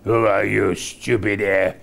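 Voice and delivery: low, menacing emperor's voice; Ming the Merciless. Retold or imitated. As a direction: loom over him as Ming. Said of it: "Ming says things like".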